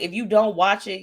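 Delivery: ordinary talk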